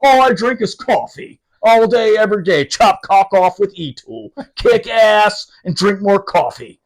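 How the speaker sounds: loud, high, bragging tough-guy voice; hammed-up career Marine boasting; gung-ho lifer. Mocking the lifer sergeant's creed, loud and boastful. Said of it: "he's a lifer".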